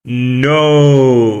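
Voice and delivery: loud voice changer; voice mod. Real, not acted. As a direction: pitch shifted through voice mod. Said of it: "That's what it sounded like".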